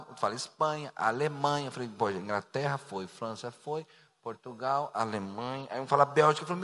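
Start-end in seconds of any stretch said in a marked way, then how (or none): 1.9-5.7 low, soft list ticked off privately; low musing to himself. Retold or imitated. Pastor checking off countries already taken in his head, low musing. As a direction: think aloud quietly, well under the voice. Recounts own silent ticking-off of countries.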